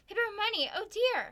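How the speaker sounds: high, loud amazed exclamation; flustered astonishment. Acted. Wife seeing a box of cash, flustered astonishment. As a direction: gasp it out in wonder.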